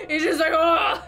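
talking through laughter; laughing. Real, not acted. Gamer mocking an edgy boss, laughing throughout. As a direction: giggle through the words.

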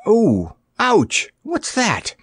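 high, sharp cries of pain; startled pain. A narrator's reading. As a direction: yelp in sudden alarm. Sudden pain and terror.

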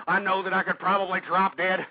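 ordinary talk

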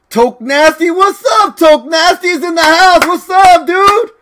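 high, thrilled shout-out; excited greeting. Real, not acted. Streamer greeting a famous visitor, excited. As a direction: hail your hero arriving.

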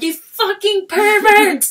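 high, slow, loud accusing shout; angry yelling. Retold or imitated. Woman storming after the flasher, angry yelling. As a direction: hurl the insult after him. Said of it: "I'm yelling at him".

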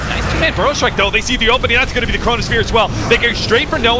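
excited high-pitched esports call; live play-by-play. Real, not acted. Caster calls the initiation to viewers, excited play-by-play. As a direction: call the team fight as it erupts.

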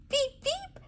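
very high tiny peeps; peeping chick. Acted. Mother peeping like a chick for her toddler. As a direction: peep like a baby chick. Animal sound game for toddler.

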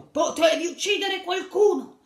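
angry gorilla dad shouting at his son. Acted. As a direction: roar at him like a furious father gorilla. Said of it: "gli gridò".

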